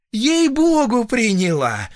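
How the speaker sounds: very high, loud oath, dropping at the end; protesting peasant woman. A narrator's reading. Patient swearing to doctor she took it, insistent. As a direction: swear it shrilly, high above your voice.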